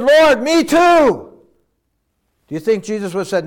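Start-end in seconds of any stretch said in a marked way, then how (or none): 0.0-1.3 eager plea. Retold or imitated. Disciple calling out in sermon.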